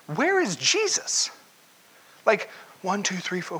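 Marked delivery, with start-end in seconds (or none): 0.1-1.3 high, startled "Where is Jesus?" called out; alarmed cry. Retold or imitated. A disciple in the storm calls out, alarmed. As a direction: suddenly realise he's missing and call out. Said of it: "somebody goes".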